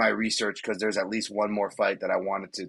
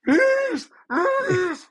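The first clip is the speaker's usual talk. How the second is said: very high, frantic Swedish-accent imitation, much louder; Swedish Chef-style yelling; 5 dB louder, much slower than usual. Imitating a Swedish fighter yelling in the cage, with a frantic high Swedish accent. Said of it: "He sounds like Swedish Chef just losing his mind".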